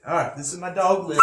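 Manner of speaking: ordinary talk, nothing marked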